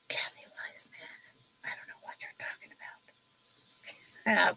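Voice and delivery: hushed, voiceless aside; soft teasing whisper. Real, not acted. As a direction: whisper it confidentially to one person. Mock confiding to a viewer.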